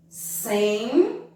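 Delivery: "Sempre" split, long rising nasal Sem, pause, short pre; "Sempre" in two syllables; nasal syllable demo. Shown as a demonstration. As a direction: hold the Sem of "Sempre" in the nose, then drop pre. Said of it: "Sem é uma sílaba de som nasal".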